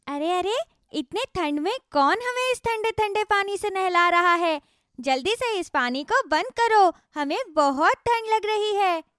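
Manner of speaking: cold fruit complaining; cute tiny creature voice; tiny magic strawberries. Acted.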